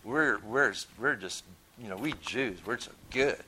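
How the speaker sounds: lightly mock Peter's smug pride; mocking boast. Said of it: "So he's mocking Peter".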